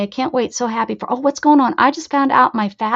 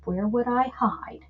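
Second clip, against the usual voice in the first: normal talking voice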